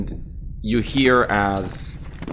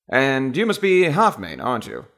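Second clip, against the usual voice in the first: higher, put-on posh British voice; Received Pronunciation; 4 semitones higher than usual. British official picking out his countryman, high-class British accent. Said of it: "with a very, very received pronunciation".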